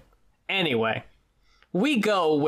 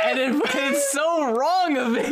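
higher, laughing through it; 8 semitones higher than usual. Real, not acted. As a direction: laugh at your own guilt. Laughing at own guilt.

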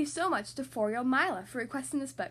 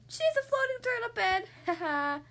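amused excited; 9 semitones higher than usual. Acted. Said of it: "Good question".

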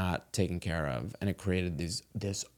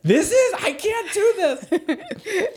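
much higher, slow words broken by laughter; laughing disbelief; 7 dB louder, much slower than usual. Retold or imitated. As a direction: laugh through it, overwhelmed. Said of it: "And I was like".

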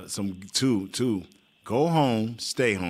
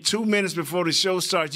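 ordinary talk

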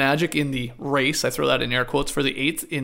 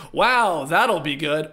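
unimpressed sarcastic wow; much slower than usual. Shown as a demonstration. Host showing one reading of a player's reaction, sarcastic. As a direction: say it with dry sarcasm, held down. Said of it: "you can say that sarcastically".

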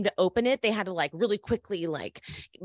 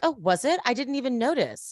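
higher-pitched, faux-clueless reply; mock innocence; 5 semitones higher than usual. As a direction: play innocent with a lifted voice. Acting feigned innocence when questioned.

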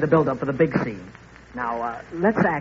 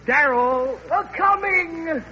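calling offstage; 11 semitones higher, much slower than usual. Acted. Calls offstage to producer.

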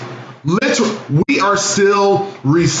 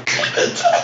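raspy whisper, voice gone; the falsetto cracks into breath; voice giving out. Real, not acted. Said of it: "I have no voice for this today".

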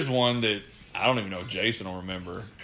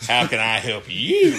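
slow, louder, half laughing; laughing disbelief; 13 semitones higher, 8 dB louder than usual. Retold or imitated. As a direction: echo it back through laughter. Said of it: "I was like".

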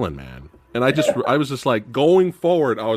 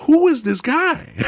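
amazed question; 13 semitones higher, slower than usual. Retold or imitated. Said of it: "I was telling my wife".

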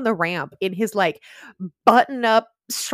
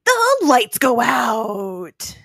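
very slow, drawn-out words; dramatic spooky announcement; 4 dB louder, much slower than usual. Acted. Recapping the locker room scare, drawn out spookily. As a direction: stretch it out like a ghost-story reveal.

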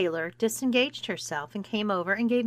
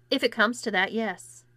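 ordinary delivery

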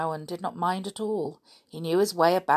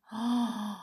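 gasp; 5 semitones higher than usual. Acted. Surprise at Lenoir's entrance.

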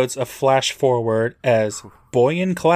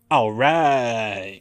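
long, stretched-out "all right"; drawn-out all right. Acted. Recapper voicing Jared's reply to Larry, drawn out. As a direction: draw the words way out. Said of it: "Jared gives him an".

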